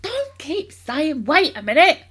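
high, loud, drawn-out scolding; impatient father shouting; exasperated shout. A narrator's reading. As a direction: throw the boy's words back at him loudly. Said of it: "shouted Mister Cranky".

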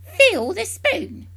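slow loud crone order; bossy grandma. A narrator's reading. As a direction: drag it out like an imperious hag. Grandma commands George.

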